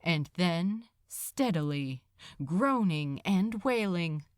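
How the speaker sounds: usual speaking voice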